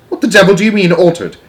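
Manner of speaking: loud, high, exasperated demand; incredulous shout. A narrator's reading. Father demanding to know about his son, incredulous shout. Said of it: "shouted Mister Jenkins".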